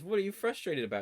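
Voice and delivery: high, light asking; curious question. Retold or imitated. Eun-chan asking him at the batting cages, light and high. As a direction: ask him brightly. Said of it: "And she goes".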